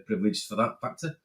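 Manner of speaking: ordinary talk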